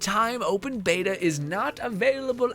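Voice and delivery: high, slow, drawn-out notice read; mock announcer. Streamer reads the beta shutdown notice to viewers, mock announcer.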